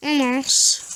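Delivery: stuffy nose from a cold; sniffly, blocked-nose voice. Real, not acted. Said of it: "je suis un peu enrhumé".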